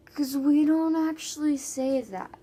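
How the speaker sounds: slow, insistent comeback to a silly question; emphatic protest. Retold or imitated. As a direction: stretch it out in exasperated protest. Acted reply to strangers' accent question.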